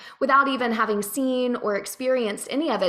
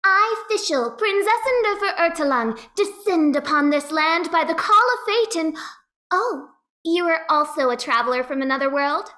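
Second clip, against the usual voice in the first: higher, lofty and theatrical, each word proclaimed; Fischl's grand princess voice; 5 semitones higher than usual. Retold or imitated. Voice actor quoting her character's haughty introduction in a high princess voice. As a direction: announce yourself like self-crowned royalty. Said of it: "she's like".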